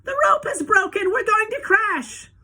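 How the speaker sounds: very high, loud shriek of panic; panicked cry. A narrator's reading. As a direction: scream as the lift falls. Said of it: "Missus T V cried out".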